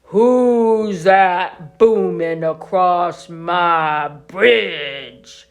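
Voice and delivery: slow, loud troll roar; menacing troll shouting at the goat; greedy troll. Acted. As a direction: bellow it slowly like a hungry troll. Troll challenges big goat.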